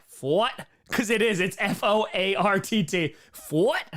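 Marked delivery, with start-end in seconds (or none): 0.1-0.5 "Fort" for "fart", rising at the end; imitated pronunciation. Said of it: "love the way he says fart". 3.3-3.8 "Fort" for "fart", high and rising; imitated pronunciation. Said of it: "love the way he says fart".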